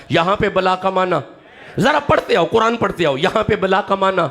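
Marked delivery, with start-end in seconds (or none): 1.8-3.2 urging call. Real, not acted. Urging congregation to recite along.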